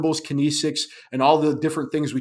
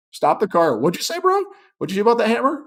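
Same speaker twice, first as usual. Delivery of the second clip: sharply higher, startled questioning; surprised cop; 8 semitones higher than usual. Retold or imitated. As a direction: pounce on the slip, surprised. Cop reacts to suspect's outburst.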